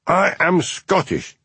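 angry shout. Acted. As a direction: bellow it, offended to the core. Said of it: "he shouted".

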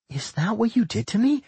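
high-pitched curious question. A narrator's reading. Millie asks Doc about what he did, curious. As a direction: ask with open curiosity, voice lifted. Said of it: "Millie asked curiously".

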